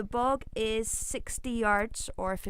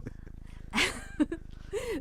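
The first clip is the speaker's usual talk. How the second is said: high laugh; 13 semitones higher, 6 dB quieter than usual. Real, not acted. Laughing at own joke.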